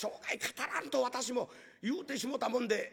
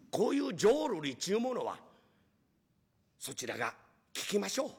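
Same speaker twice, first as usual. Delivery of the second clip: ordinary talk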